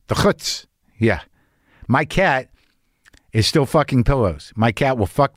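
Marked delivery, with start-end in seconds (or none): 0.1-0.6 breathy throaty "chutz"; whispered Yiddish sound. Shown as a demonstration. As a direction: push out "chutz" on a hiss of air. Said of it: "Not even words".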